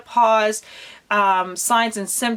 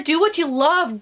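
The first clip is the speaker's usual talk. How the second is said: ordinary talk, nothing marked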